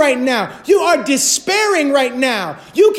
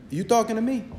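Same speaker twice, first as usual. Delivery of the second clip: muttered tough-guy repeat; 3 semitones lower, 8 dB quieter, faster than usual. Pretend. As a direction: lower and softer, "You talking to me?" muttered under the breath. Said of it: "And what does God say?".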